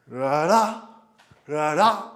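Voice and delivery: "ra ra" with the second "ra" punched loud; loud rhythmic "ra ra" cue, rising on the accent; accented dance syllables. Shown as a demonstration. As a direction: drive the step rhythm, hitting the second "ra" hard. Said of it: "where is the accent?".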